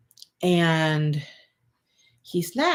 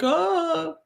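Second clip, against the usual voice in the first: emotional cry; 13 semitones higher than usual. Retold or imitated. Lost it at the airport.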